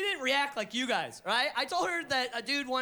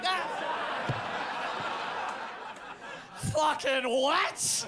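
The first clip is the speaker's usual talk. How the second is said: shocked gasp; 4 semitones higher, much slower than usual. Retold or imitated. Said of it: "We're like".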